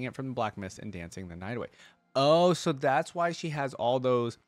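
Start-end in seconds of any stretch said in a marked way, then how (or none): 2.1-4.4 louder, higher, drawn-out "oh"; sudden realization. Real, not acted. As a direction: react as it clicks, slow and emphatic. Sudden realization while reacting to lore.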